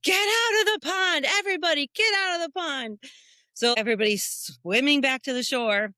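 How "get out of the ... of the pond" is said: high, loud urgent call to get out; urgent shout. Retold or imitated. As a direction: order everyone out of the water. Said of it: "They're like".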